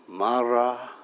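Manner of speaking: Mara spoken softly on an inhale; on the in-breath. Shown as a demonstration. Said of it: "as I breathe in, I breathe in the first two syllables".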